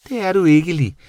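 high, loud creature protest; surprised, happy bat. A narrator's reading. Bat cheering up her guilty friend, surprised high voice. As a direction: contradict him brightly, high-pitched. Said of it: "Hun lød overrasket og virkede pludselig rigtig glad".

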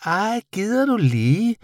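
high, drawn-out creature voice; slow, high-pitched little bat; bat character. A narrator's reading. Bat scolding the boy, high character voice.

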